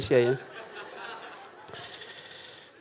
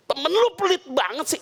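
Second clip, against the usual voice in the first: higher, mock-annoyed sibling; annoyed complaint; 11 semitones higher than usual. Older brother griping about the stingy host. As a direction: grumble like a fed-up older brother.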